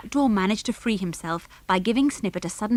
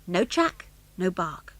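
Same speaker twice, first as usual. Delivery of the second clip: ordinary delivery, nothing marked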